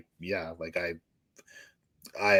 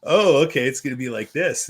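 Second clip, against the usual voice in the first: mock surprised realisation; 5 semitones higher, 6 dB louder than usual. Recalls being jumped on by Marty.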